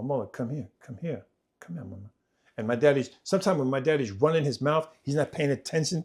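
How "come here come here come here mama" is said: soft, secretive retold call; quietly coaxing, much softer; hushed beckoning. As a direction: call her over without being overheard. Child secretly beckons mother past father.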